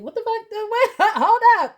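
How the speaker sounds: high, rising disbelief, laughing at the end; shocked. Retold or imitated. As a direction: squeal in disbelief. Shocked reaction to waitress.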